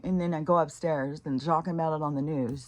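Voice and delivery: usual speaking voice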